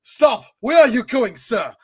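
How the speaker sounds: sudden loud imitation of an actor; acted movie challenge. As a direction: call out like a hammed-up film hero. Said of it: "he like".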